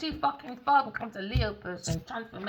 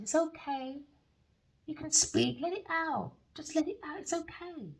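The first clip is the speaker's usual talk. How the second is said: a soft higher coaxing voice; lightly voiced soothing woman; 8 semitones higher, 4 dB quieter than usual. She soothes the man, mild high gentle coaxing. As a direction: gently coax him to open up. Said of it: "She said".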